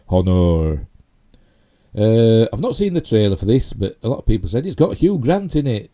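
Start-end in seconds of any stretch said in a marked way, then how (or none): 0.1-0.9 "Honor" with a sounded H and a full "-or" ending; louder, over-pronounced "Honor", as if read letter by letter; mock spelling pronunciation of Honor. Shown as a demonstration. Film fan teasing American spelling, "Honor" over-pronounced as spelt. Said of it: "they spelt it wrong".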